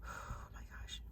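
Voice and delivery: sighed, voiceless aside; whispered, barely-there disbelief; overcome whisper. Real, not acted. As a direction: breathe it out in awe. Overcome by her own story.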